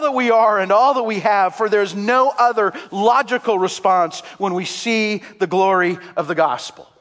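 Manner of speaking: high, loud fervent preaching; full-force preaching. Acted. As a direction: preach it out at the top of your voice.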